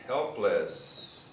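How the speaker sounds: ordinary delivery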